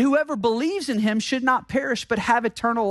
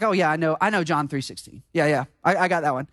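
dismissive know-it-all; 9 semitones lower, much faster than usual. Retold or imitated. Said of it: "what happens is like".

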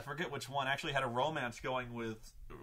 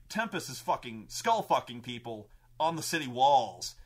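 ordinary talk

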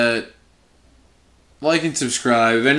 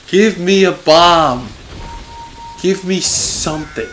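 loud, higher, hungry for loot; real excitement, louder and higher; eager demand; 6 semitones higher, 6 dB louder than usual. Real, not acted. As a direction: shout for the reward.

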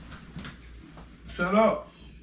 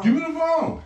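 loud; 9 semitones higher, 4 dB louder than usual. Real, not acted. Demanding phone in scuffle.

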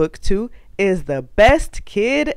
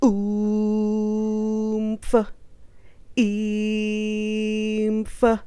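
strained grunts; 3 semitones higher, 4 dB quieter than usual. Acted. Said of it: "make silly sounds like".

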